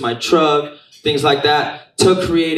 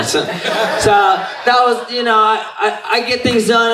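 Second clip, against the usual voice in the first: laughing; 9 semitones higher, slower than usual. Real, not acted. Laughing about Santa Fe turnout.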